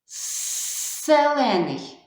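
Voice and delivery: «Селений» slowly with a hissed С instead of З; deliberately wrong word. Shown as a demonstration. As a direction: drag out «Селений», stressing the wrong С. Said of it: "буду казати звук «с»". (навмисно неправильне «Селений», повільно)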